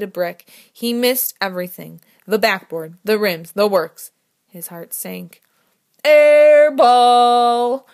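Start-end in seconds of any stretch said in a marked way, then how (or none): 6.0-7.9 loud mocking call of air ball; chortling. A narrator's reading. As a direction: jeer it out with a chortle.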